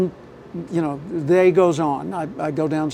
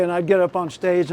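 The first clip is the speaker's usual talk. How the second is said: ordinary talk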